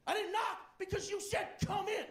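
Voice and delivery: high shouted retort; angry shout. Retold or imitated. Delivery guy angrily answering the comedian, high shout. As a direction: snap back up high, offended. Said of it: "angrily goes".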